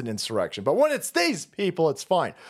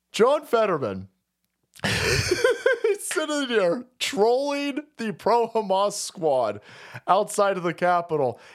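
usual speaking voice

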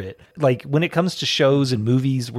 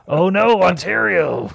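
high, loud comic lament; hammed-up "oh no" at a joke offence; mock dismay; 5 semitones higher, 4 dB louder, slower than usual. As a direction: wail it in exaggerated alarm.

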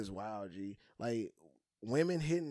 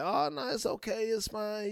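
much higher, brushing it off; mock high-voiced wife; 9 semitones higher than usual. Friend mimicking a wife who shrugs off flirting. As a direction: wave it off lightly.

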